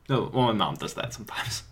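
ordinary talk

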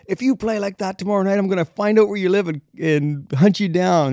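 high, loud put-on threat, slowing at the end; mock yelling. Gene threatening the bassist, loud high mock yell. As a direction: bellow the threat, dragging out "hunt you down". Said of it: "He's yelling at me".

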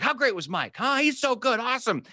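high, over-the-top hyped praise; imitating a host hyping up the opener; gushing praise. Comedian hyping the opener to the crowd, gushing high. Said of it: "thirty seconds being like".

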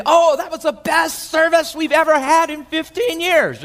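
high, far gushing voice mocking a churchgoer; hammed-up churchgoer raving about the service. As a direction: gush like a busybody who was there.

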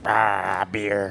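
low groan. Retold or imitated. Groaning quote of coworker.

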